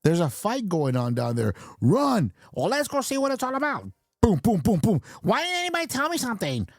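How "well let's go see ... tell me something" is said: high-pitched panicky bystander voice; scared onlooker. Acted. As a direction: squeal it like a startled onlooker.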